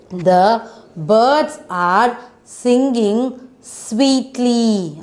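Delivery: very slow and clearly spaced; slow model reading. Shown as a demonstration. Teacher reading the sentence slowly for the class to follow. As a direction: lay each word out slowly. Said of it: "teacher models sentence for children".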